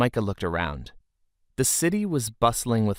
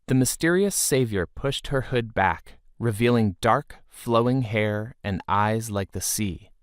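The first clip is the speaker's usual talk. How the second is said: plain reading voice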